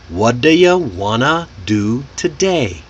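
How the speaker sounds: What do you wanna do today, reduced but unhurried; slow and clear. Shown as a demonstration. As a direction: ease slowly through "whaddya wanna do today". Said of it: "Slow and clear".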